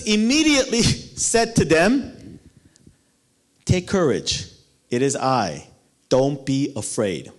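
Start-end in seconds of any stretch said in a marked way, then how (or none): 3.7-7.3 calm Jesus. Acted. Jesus calming the disciples.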